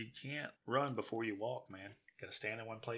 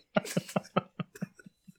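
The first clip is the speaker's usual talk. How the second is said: whispered laugh; 5 dB louder than usual. Real, not acted.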